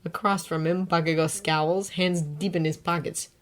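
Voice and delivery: plain reading voice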